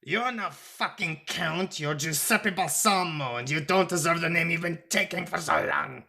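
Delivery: high-pitched furious denunciation; angry shout. A narrator's reading. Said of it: "he shouted".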